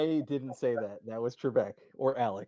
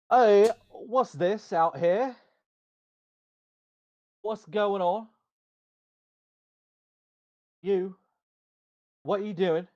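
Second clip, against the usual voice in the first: approaching armored guard; 8 semitones higher than usual. Acted. Armored man approaches the party outside, suspicious guard voice. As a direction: challenge them in a higher guard voice. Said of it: "there is a man who is approaching".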